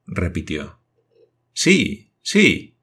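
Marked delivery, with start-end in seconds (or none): 1.5-2.8 high, eager double yes. A narrator's reading. The Indian eagerly confirming to Glenarvan. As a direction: nod it out brightly. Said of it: "respondió el indígena".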